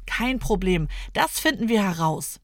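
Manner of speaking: plain reading voice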